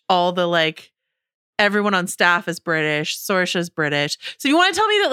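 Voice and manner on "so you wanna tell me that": high-pitched, disbelieving pushback; incredulous challenge. Real, not acted. Host disputes the cast's mismatched accents, incredulous.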